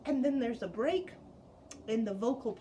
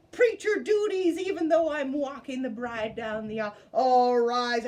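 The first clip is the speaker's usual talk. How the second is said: louder preacher-dad call; loud announcing dad; 8 dB louder than usual. Retold or imitated. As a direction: proclaim it to the whole church. Father-preacher announcing loudly.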